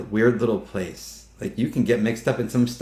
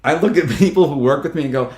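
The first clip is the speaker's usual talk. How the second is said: normal talking voice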